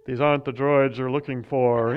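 lightly voiced Jedi; a hint of Obi-Wan's calm command; Jedi mind trick. Quoting Star Wars Jedi line.

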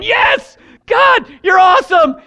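retelling a shouted yes to God; ecstatic praise. Retold or imitated. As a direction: cry out to God, thrilled. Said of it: "And I'm just going".

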